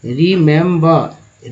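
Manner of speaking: "रिमेंबर" drawn out very slowly, syllable by syllable; teacher demonstrating "रिमेंबर" slowly; slow model word. Shown as a demonstration. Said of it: "देखिए, रीमेम".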